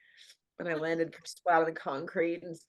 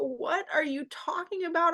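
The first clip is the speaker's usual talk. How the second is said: pleading disbelief; 10 semitones higher, 3 dB louder than usual. Retold or imitated. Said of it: "and I go".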